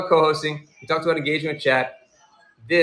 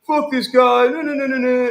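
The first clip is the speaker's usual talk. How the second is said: higher, louder mock rant; mock angry streamer; 10 semitones higher, 4 dB louder than usual. To the troll, a higher hammed-up angry outburst. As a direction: ham up a furious host. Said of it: "do you wanna hang out with me if I'm like".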